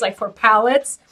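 normal talking voice

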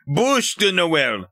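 Bûche de Noël said slowly and pointedly French; correcting the swine with Bûche de Noël; mock-snobbish Bûche de Noël; proper French. Shown as a demonstration. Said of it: "You uncultured swine".

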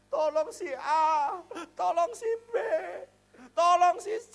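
high, crying plea, slow at first; tearful prayer. Retold or imitated. Pastor recalls praying with his wife, crying and pleading. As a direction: beg through tears for each name. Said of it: "saya sama istri saya menangis untuk berdoa".

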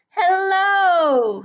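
high, loud drawn-out call; storybook shout, high and falling; shouted greeting. A narrator's reading. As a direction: holler a long hello. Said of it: "shouted a tortoise".

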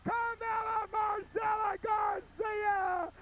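high, slow, stretched-out shout; drawn-out shouted name. Acted. Storyteller ends the tale by bellowing the name. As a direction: belt the name out like a grand finale. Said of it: "shouted name at end".

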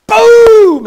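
loud mock gunshot boom; big shouted gunshot sound. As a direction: bark out a gunshot. Mock gunshot in sermon story.

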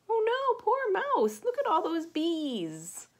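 high, loud cry of dismay; alarmed exclamation. Acted. Storyteller crying out at the bees on the mouse's head, high and alarmed.

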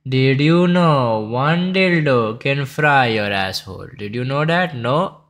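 mocking Indian accent. Reacting to a chess video, he mocks the creator's Indian accent. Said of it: "this accent thing he has".

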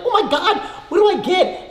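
high, loud exaggerated fretting; hammed-up helpless wail; mock panicked shopper. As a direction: act out overwhelmed grocery-store panic. Acts out panicked grocery shopper.

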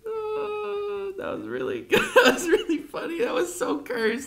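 high, teary laughing squeak; real tears of laughter; crying laughing. Real, not acted. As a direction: wheeze it out through laughter.

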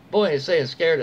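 ordinary delivery

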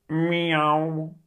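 high, loud, long meow; cat meow. A narrator's reading. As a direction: meow back instead of answering. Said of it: "zegt Dikke Teun weer".

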